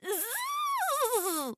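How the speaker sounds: high buzzing zzz; buzzing bee. Acted. Bee flying out of the hive, high buzz.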